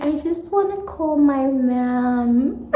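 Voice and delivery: high, slow teary whine; mock crying plea. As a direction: whimper it like a child. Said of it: "I'm like".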